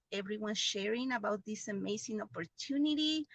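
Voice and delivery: usual speaking voice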